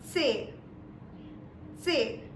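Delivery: "C" said with a slight short t at the start; German letter C. Shown as a demonstration. Teacher shows the t at the start of the German C. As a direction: begin "C" with a short t sound. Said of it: "is pronounced as".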